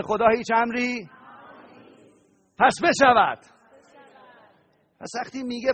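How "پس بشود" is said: louder proclamation of پس بشود; loud declaration. Real, not acted. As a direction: declare it like a verdict. Preacher's climactic declaration.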